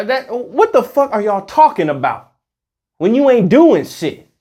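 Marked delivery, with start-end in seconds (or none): none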